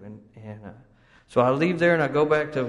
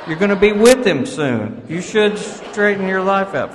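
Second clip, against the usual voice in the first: louder, higher, laughing through it; laughing mockery; 6 semitones higher, 9 dB louder than usual. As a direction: tease the old folks with a laugh. Mocking young self's view.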